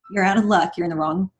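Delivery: usual speaking voice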